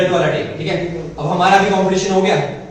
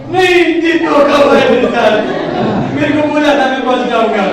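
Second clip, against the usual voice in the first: mock tearful wail, higher and louder; crying complaint; 7 semitones higher, 6 dB louder than usual. Rival crying to the seniors about being cheated, mock wail. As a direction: imitate a boy sobbing that he was cheated. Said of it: "वो बंदा रो गया".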